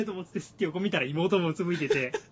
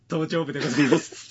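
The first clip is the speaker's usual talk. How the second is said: quoting while laughing; words breaking into laughter; laughing through the quote; 9 dB louder than usual. Retold or imitated. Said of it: "つって。".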